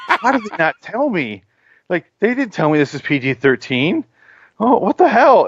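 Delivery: high-pitched indignant disbelief; retold outrage. Fan who walked into a PG thirteen movie, indignant. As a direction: play up the betrayed customer. Retelling outrage at not being warned.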